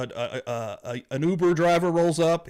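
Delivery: normal talking voice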